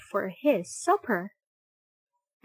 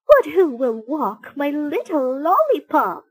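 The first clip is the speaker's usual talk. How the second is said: worried neighbour; 4 semitones higher, 6 dB louder than usual. Acted. Missus T Berry pleads about dog.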